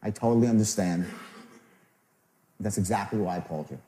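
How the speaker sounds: low, soft, sincere reassurance; calm, caring sales tone; understanding tonality. Shown as a demonstration.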